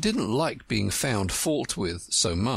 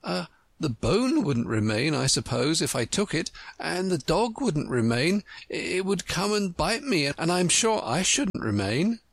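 put-on higher-pitched girl reasoning it out; pondering Alice; 9 semitones higher than usual. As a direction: think aloud as a puzzled young pupil. Voices Alice considering.

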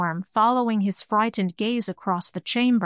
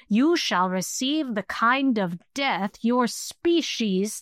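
higher, rasping creature voice full of loathing; hate-filled rasp; 3 semitones higher, slower than usual. Acted. Skull-bearer threatens the mortals with death, hate-filled rasp. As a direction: promise death like a gloating demon. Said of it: "the hate-filled voice rasped at them".

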